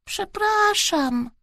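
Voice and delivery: put-on high, slow, small child voice; sheepish drawn-out sorry; abashed apology. A narrator's reading. Boy caught sniffing, apologising abashedly. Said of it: "zawstydził się Bartuś".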